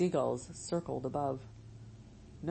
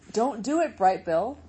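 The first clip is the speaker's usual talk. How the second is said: urgent call; 5 semitones higher, 6 dB louder than usual. Acted. Flock calls out to their leader.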